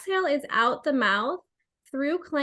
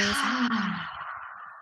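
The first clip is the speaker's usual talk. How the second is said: soft falling exhale sigh; audible sigh; 3 semitones lower, 5 dB quieter than usual. Shown as a demonstration. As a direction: sigh it all out. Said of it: "making some noise".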